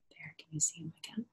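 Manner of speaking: fast hushed whisper; quick soft whispered question. Real, not acted. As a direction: whisper so the little lights stay secret. Showing children the glowing book.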